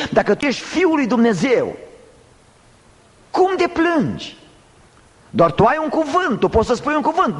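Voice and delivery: very high, incredulous-style taunt; accusing challenge. Acted. Preacher voicing accusers of the weeping Christ, accusing challenge. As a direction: throw it out as a high-pitched accusation. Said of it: "aceasta a fost acuzația".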